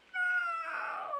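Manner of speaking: high, hammed-up mother's cry; mock wail. Mother mourning her grown son, hammed-up high cry. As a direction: squeal it like a mother losing her baby. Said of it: "And I'm like".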